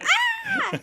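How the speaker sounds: high mock scream; piercingly high answering shriek, played for fun; Janice. Janice screaming right back at Joey, mock scream. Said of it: "Janice screams right back".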